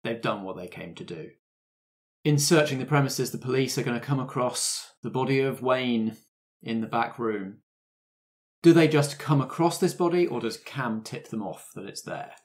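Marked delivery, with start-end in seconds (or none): none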